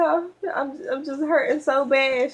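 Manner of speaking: high, teary voice breaking up; retold crying. Telling her mother-in-law how bad it hurts, high and teary. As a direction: plead through the pain, near sobbing. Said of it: "I was crying".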